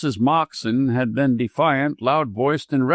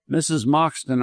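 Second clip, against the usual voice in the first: usual speaking voice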